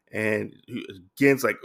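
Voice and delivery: ordinary delivery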